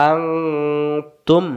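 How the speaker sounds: আং held long and nasal before তুম; hidden nun. Shown as a demonstration. Teacher reviewing the example, long nasal আং then তুম. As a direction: hum through আং, then close on তুম. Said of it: "এটা গোপন কেন হয়েছে".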